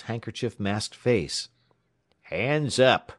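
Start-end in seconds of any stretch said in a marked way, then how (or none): 2.2-3.1 barked holdup command; hoarse order. A narrator's reading. Tramp barking at the clerk, rough. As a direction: snap it out like a robber. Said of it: "ordered the tramp hoarsely".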